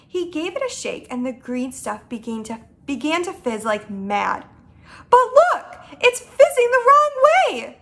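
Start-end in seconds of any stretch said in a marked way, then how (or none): 5.1-7.8 high, loud exclamation; pitched-up, urgent outburst; alarmed cry. Acted. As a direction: call out in startled astonishment. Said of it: "Sophie cried".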